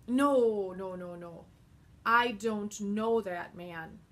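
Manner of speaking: loud denial, the first "No" punched loud; Peter denying. Acted. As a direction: deny it hard and fast. Peter denies loudly.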